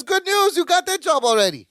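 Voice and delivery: high, chirpy mock Balky, laughing at the end; cheerful Balky. Balky cheerfully answering cousin Larry, high and laughing. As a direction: say it brightly high, breaking into a laugh.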